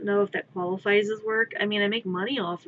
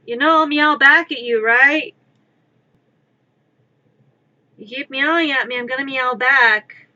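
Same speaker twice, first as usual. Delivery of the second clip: mock threat to cat; 3 semitones higher, 8 dB louder than usual. Real, not acted.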